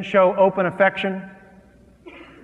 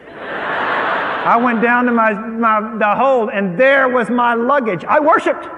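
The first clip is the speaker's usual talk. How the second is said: slow start rising to a loud, high, laughing shout; joyful build; 5 semitones higher, 4 dB louder, slower than usual. Real, not acted. Preacher telling congregation he found his luggage, building excitement. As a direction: let the joy swell to a shout.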